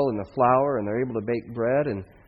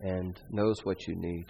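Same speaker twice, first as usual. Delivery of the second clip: soft, lower tender words; gentle reassurance; 3 semitones lower, 8 dB quieter than usual. Acted. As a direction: hand the child back tenderly. Prophet gently returns son to mother.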